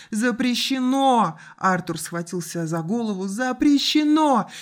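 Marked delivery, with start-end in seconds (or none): 0.1-1.4 high, loud, drawn-out cry; slow anguished shout; screaming. A narrator's reading. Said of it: "и закричал". 3.3-4.5 high, loud, stretched-out yell; long anguished shout; terrible scream. A narrator's reading. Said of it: "Закричал так страшно".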